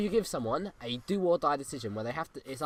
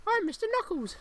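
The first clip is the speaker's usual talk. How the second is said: puppet voice. Pretend. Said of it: "human ventriloquist act".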